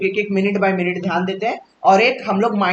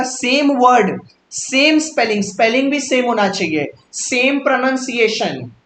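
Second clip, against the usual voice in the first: higher and slow, each "सेम" stressed; emphatic slow correction; 4 semitones higher than usual. Shown as a demonstration. Teacher correcting a student's wrong example, slow and insistent. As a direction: firmly restate the rule, slowly. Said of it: "देखो ना, मैंने क्या बोला".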